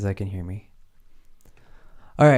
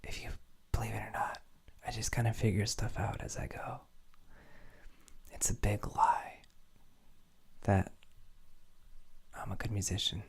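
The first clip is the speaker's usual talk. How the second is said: secretive whispered confession; 12 dB quieter than usual. Pretend. Producer whispering a joking confession to viewers.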